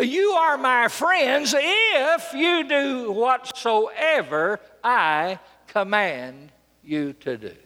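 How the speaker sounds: very slow, measured command; solemn proclamation. Retold or imitated. Jesus to his friends, slow solemn proclamation. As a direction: draw each word out, starting high and stepping down.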